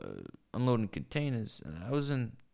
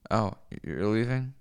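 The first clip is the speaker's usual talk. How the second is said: ordinary delivery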